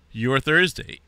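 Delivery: hiccuping mid-word; hiccups. Real, not acted. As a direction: talk through a hiccup. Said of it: "I got hiccups all of a sudden".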